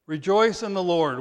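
loud, ringing sermon read; booming preacher declaring the verse; forceful scripture reading. Acted. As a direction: proclaim it from the pulpit.

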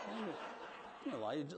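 ordinary talk, nothing marked

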